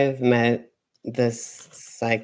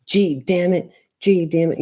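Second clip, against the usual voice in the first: raised, exasperated habitual curse; mild cursing; 7 semitones higher, 5 dB louder than usual. Retold or imitated. Quoting own everyday cuss habit, mild cursing. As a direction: toss it out as a mock-annoyed curse. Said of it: "And I always say".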